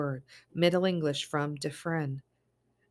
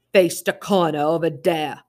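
loud barked command; shouting order; 11 dB louder than usual. Acted. Masked intruder shouting orders at the mother. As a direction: bark it at the family. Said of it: "the man shouted".

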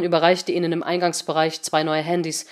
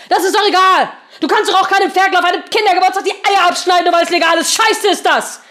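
furious shouting, much higher; 12 semitones higher, 9 dB louder than usual. Acted. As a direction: yell it in outrage. Seemann shouts at Henry.

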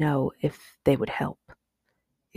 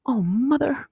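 put-on high, tremulous child's cry; relieved little girl about to cry; near tears; 9 semitones higher, slower than usual. A narrator's reading. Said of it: "near tears".

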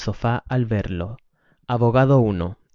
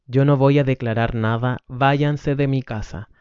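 ordinary talk, nothing marked

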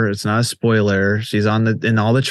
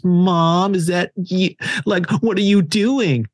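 put-on higher, worried young daughter; daughter calling out; 6 semitones higher than usual. Daughter in the horror film calling to her possessed mom, higher and worried. Daughter voiced in retold scene.

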